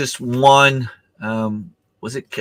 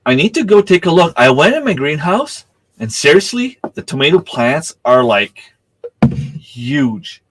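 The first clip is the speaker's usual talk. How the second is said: rising thrill, building to "huge"; excited; 7 semitones higher, 4 dB louder than usual. Real, not acted. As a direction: voice jumps high and loud over the huge tomatoes. Excited about huge tomato plants.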